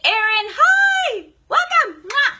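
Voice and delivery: high, loud, drawn-out squeal of hello; excited greeting. Real, not acted. Host welcomes viewer joining the live, excited. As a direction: greet them in a delighted high shout.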